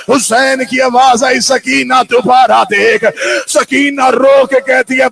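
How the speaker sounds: urgent, projected lament narration; impassioned majlis preaching. Acted. Preacher relays Hussain answering Sakina, urgent declamation. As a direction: keep the lament driving at full voice. Majlis recitation at full force.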